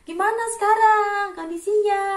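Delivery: high, loud hollered question; shouted friendly greeting. Acted. Indonesian asking how a friend is, shouted friendly greeting. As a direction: call it across a distance. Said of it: "orang Indonesia tuh kebanyakan ngomong itu".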